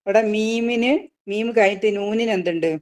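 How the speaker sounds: usual speaking voice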